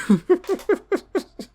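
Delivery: high-pitched giggling; real high, tittering laugh. Real, not acted. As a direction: crack up at your own remark. Laughs at imagined shock joke.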